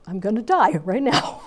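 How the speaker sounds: mock panic dissolving into a laugh; laughing fear. Recalling her thought before the jump, laughing. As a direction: laugh at your own terror. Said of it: "And I'm thinking".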